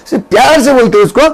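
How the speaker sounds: ordinary delivery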